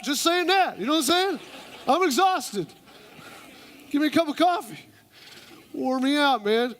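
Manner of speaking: hammed-up worn-out dude; playing a talked-out guy; tired guy. Man grunting short reply.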